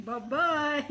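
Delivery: usual speaking voice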